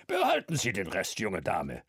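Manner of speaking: lightly voiced old man, generous. A narrator's reading. Old man tipping the barmaid, lightly voiced. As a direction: grandly wave off the change.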